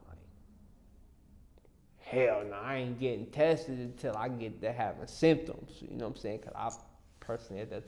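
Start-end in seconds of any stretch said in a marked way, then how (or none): 2.0-5.8 loudly brushing it off, retold; defiant loud refusal. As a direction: bark a loud hell no at the idea. Defiant refusal to his mom.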